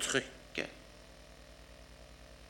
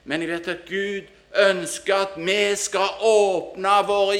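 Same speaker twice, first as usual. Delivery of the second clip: high, loud, slow preacher's appeal; impassioned preaching; 7 semitones higher, 10 dB louder than usual. Acted. Preacher urging friends to open their hearts, impassioned preaching. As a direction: drive each phrase home to the congregation.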